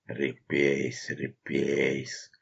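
soft, displeased muttering of the name; displeased grumbling. A narrator's reading. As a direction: grumble it under your breath. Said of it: "с неудовольствием бурчал Меринов".

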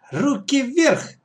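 put-on high, drawn-out girl's command; child playing commander. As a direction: give a slow high-pitched order at gunpoint. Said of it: "скомандовала Катя".

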